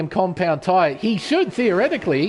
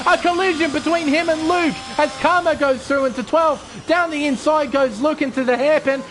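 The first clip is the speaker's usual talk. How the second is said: excited, higher race play-by-play; motorsport commentator; 6 semitones higher than usual. Acted. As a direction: call the race live, pushing the energy.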